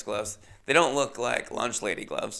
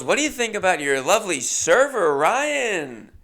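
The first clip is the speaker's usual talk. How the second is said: higher, louder teasing emcee; egging the crowd on; host teasing; 6 semitones higher, 4 dB louder than usual. Retold or imitated. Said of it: "And she goes".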